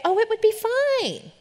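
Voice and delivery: high, slow airy dismissal; mock the carefree answer; naive breeziness. Mocking the ignorant reply about losing her voice, high and airy. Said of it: "I'm not gonna be dumb and, and ignorantly say".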